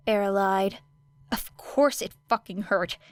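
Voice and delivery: usual speaking voice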